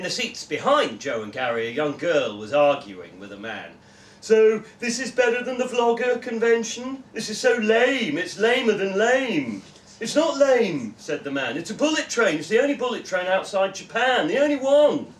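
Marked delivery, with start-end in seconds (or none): 4.2-9.6 put-on higher, louder bratty girl voice; whiny girl. Sulky vlogger girl complains to the man, higher and whiny. 10.0-15.1 higher, louder insistent voice; defensive man. Acted. Man defends the bullet train to the girl, louder.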